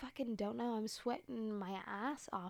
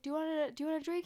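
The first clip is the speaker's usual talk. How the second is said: fast, higher imitation of a yelled offer; retold yelling; 7 semitones higher, 6 dB louder, much faster than usual. Man at the club offers her a drink, imitated yelling. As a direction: mimic him calling out, quick and raised. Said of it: "He was, like, yelling at me".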